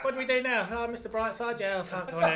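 high, loud, laughing mock guest; mock bored guest. Bored sober guest mocked, high and laughing.

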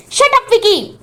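sharp high cry of shut up; shouting at brother. A narrator's reading. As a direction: cut him off with a high shout. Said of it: "అని అరుస్తుంది అరవింద".

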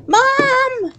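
very high shrill call for mom; desperate cry. Acted. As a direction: cry out for help. Trapped, calling for mother.

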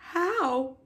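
high, plaintive one-word question; little pig's question. A narrator's reading. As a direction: ask it high like a piglet. Wilbur asks Charlotte in story.

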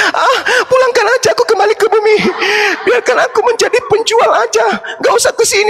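high, pleading woman's voice; begging Rasmi. Acted. Rasmi begging to go back to earth, high pleading whine.

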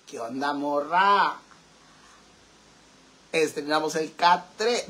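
slow, low mock-macho delivery; put-on man's voice. Streamer chatting up a girl as a man, put-on male voice. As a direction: draw it out low like a man trying it on. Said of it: "¿cómo es la voz de hombre?".

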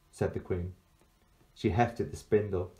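ordinary delivery, nothing marked

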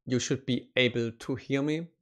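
ordinary delivery

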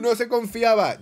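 high, loud triumphant cry; excited shout. Real, not acted. Celebra la skin conseguida. (grito triunfal y agudo)

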